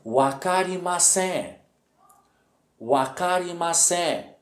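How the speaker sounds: teacher modelling "wakarimasen" slowly; model pronunciation. Shown as a demonstration. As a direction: say "wakarimasen" slowly for learners, syllables drawn out. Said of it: "nós temos a expressão".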